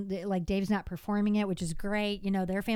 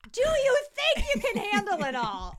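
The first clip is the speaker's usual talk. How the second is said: high, louder, laughing mock of a worried accountant; 10 semitones higher, 5 dB louder than usual. Retelling the accountant's warning, high laughing mock.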